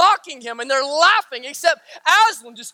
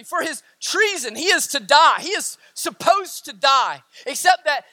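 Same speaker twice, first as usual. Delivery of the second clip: usual speaking voice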